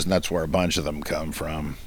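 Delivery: ordinary delivery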